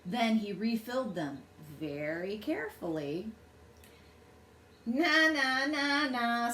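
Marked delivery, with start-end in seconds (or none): 4.8-6.5 lightly voiced bird jeering; a hint of a snorting bird taunting. A narrator's reading. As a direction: tease like a smug bird. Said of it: "snorted the flugel bird".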